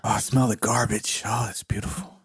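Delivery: hushed mock rapture over garbage; mocking New York's garbage in a sarcastic whisper. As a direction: whisper it like it's lovely, dripping sarcasm.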